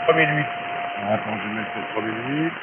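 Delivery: usual speaking voice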